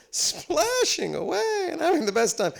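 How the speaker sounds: high, loud sing-out of fun; mock frolicking glee. Actors frolicking for the cameras in freezing water, mock glee.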